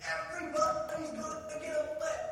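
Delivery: very high-pitched imitation of an excited child; excited kid's yell. Kid lines up the others for ice cream, high excited yell. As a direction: play a thrilled kid announcing to the crowd. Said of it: "and he goes".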